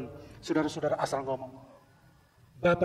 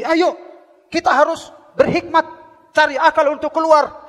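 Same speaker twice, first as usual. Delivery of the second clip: urgent call; 12 semitones higher, 11 dB louder than usual. Acted. Companions urging escape, voiced.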